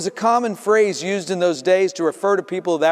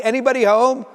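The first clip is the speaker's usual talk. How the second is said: calling at the door, raised voice; 7 semitones higher, 4 dB louder, faster than usual. Acted. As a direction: call loudly through the door. Mother knocking loudly at door.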